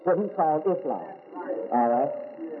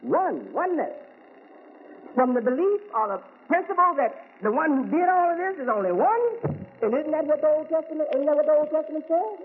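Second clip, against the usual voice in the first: higher, raised preaching voice; 10 semitones higher than usual. Acted. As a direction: lift it up and preach to the congregation. Rising into full preaching.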